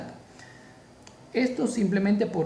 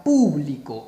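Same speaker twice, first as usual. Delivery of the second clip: público with a long, loud PÚ; stress on first syllable; 3 semitones lower, 6 dB louder, slower than usual. Shown as a demonstration. Teacher showing the esdrújula form, público stressed on PÚ. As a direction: punch and hold PÚ in público. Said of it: "mayor fuerza de voz en pu".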